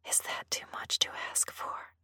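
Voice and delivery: hushed, whispered plea. A narrator's reading. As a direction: barely breathe the question. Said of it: "I whispered".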